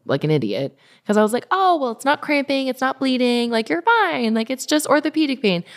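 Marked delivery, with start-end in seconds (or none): 1.5-5.6 higher-pitched mock-breezy self-talk; imitating herself talking the worry away; breezy self-reassurance. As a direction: brush it off in a higher voice. Retelling her self-reassurance through pain.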